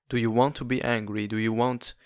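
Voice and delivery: ordinary delivery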